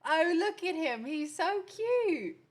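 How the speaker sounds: high, drawn-out gushing; adoring coo. Real, not acted. Gushing that he's so cute, high drawn-out adoring coo. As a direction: coo adoringly, stretching the last words.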